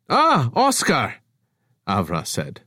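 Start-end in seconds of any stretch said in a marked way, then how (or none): 0.1-1.2 high, slow, overly delighted hello; slightly manic greeting. Acted. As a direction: greet him with manic cheer. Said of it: "slightly manic".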